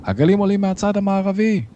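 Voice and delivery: high, urgent sailor's cry; shouted warning. A narrator's reading. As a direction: call out a warning, pitched far up. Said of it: "צעק מלאך אחר". (צעקת אזהרה גבוהה)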